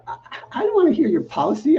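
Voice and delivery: exasperated. Retold or imitated. Retold exasperated outburst at manager.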